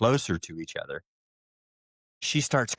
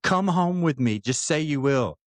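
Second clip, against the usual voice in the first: higher, imploring; pleading, pitched up high; passionate retold plea; 6 semitones higher than usual. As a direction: act out an impassioned plea. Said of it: "she starts getting more passionate about it".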